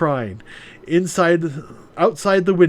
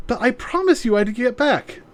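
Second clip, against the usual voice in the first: put-on pleading young woman; 9 semitones higher than usual. Voices Maya.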